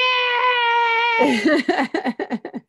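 high delighted squeal of laughter; joyful laughing shriek. Real, not acted. Celebrating her ordination news with the group, joyful shriek. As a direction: burst out in a happy shriek.

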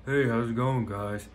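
ordinary talk, nothing marked

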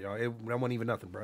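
usual speaking voice

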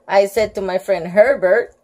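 usual speaking voice

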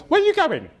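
very high-pitched mimicked woman; hammed-up high female voice; nosy woman neighbour. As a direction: squawk it like a prying neighbour. There's a woman.

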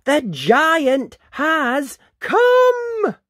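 high, loud, stretched-out announcement; alarmed yell. A narrator's reading. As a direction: yell it slowly, every word a warning. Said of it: "Missus Jones yelled".

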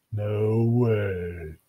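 drawn-out, monotone bored grumble; sulky refusal; grumbling. Acted. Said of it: "Bill grumbled".

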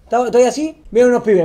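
normal talking voice

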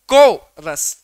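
"Coarse" with "को" punched loud and long; stressed first syllable. Shown as a demonstration. Teacher showing a trochee example, first syllable of "coarse" stressed hard. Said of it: "ट्रोकी के".

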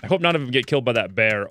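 ordinary delivery

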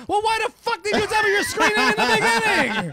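high, loud, laughing mock outburst; exasperated yell. DJ yelling at a fan who gave his real name, high and exasperated.

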